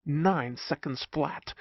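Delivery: ordinary delivery, nothing marked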